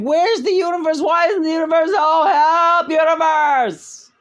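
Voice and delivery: very high, loud mock wail; shrieking mock cry for help; mocking whiny plea. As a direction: wail for the universe like a helpless seeker. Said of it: "sitting around saying".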